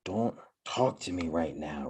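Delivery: low, soft, slow inner grumble. Retold or imitated. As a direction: say it under your breath, irritated. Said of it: "That's what I said in my head".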